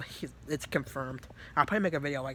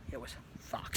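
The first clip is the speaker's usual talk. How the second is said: whispered aside; 2 semitones lower, 7 dB quieter than usual. Real, not acted. Telling viewers how bad it was, whispered. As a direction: drop into a breathy whisper. After nervous laugh, grim aside.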